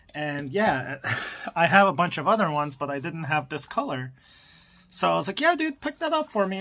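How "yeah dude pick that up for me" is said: eager. Retold or imitated. Retells his eager phone reply.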